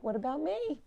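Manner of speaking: high, put-on plea; light cartoon pet voice; mock needy pet voice. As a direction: voice the pet whining for attention. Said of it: "She's like".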